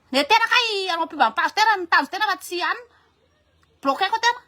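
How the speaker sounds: high, loud burst of protest; indignant outburst. Acted. Reacting to a plug for a page, loudly indignant. Acted outburst after the polite interview.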